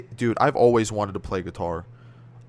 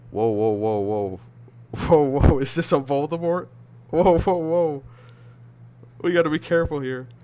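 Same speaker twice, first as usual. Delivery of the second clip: alarmed whoa; 3 semitones higher, 3 dB louder than usual. Real, not acted. Alarmed at a scary chat name.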